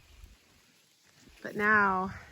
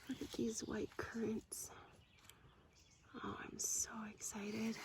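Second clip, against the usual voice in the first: whisper; 2 semitones higher, 12 dB quieter than usual. Real, not acted.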